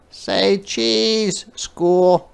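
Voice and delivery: put-on high little girl voice, cheerful; a small girl snapping photos, high and bright; little girl playing photographer; Ruthie's voice.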